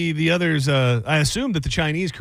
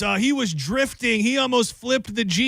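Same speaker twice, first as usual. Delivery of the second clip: higher, slow mock whiny tattling; imitating a kid telling on his uncle to his parents; tattling nephew; 8 semitones higher, slower than usual. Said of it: "telling his parents".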